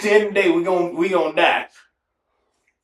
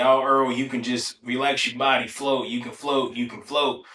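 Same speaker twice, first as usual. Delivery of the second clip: soft, low, coaxing the scared swimmer along; soothing coaxing; 5 semitones lower, 7 dB quieter than usual. Retold or imitated. Pool friends coaxing Earl to float, softly soothing. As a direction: lull him like a nervous kid, softer. People in pool coaching him.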